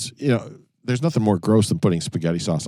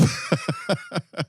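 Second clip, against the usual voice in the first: high laugh; 11 semitones higher, 8 dB quieter than usual. Real, not acted. Laughs at ketchup idea.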